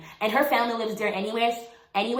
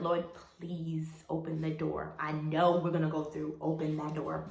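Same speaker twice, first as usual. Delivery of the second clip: retold prayer; 5 semitones lower, 9 dB quieter than usual. Contestant praying to the Lord, hushed and low. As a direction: pray it quietly, slowing on the plea. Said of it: "I was praying to the Lord".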